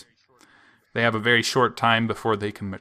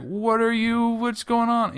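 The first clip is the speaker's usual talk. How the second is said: startled confusion; 12 semitones higher than usual. Retold or imitated. Character confused, voiced higher.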